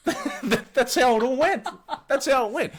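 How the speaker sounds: high, laughing through the words; amused, laugh-filled shrug-off. Real, not acted. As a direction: crack up while defending the story. Teller laughs at horrified reaction.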